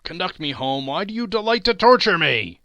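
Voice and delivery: pained loud plea; anguished plea. A narrator's reading. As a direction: plead to be spared. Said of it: "said Scrooge".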